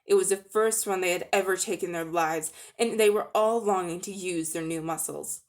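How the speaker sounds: ordinary delivery, nothing marked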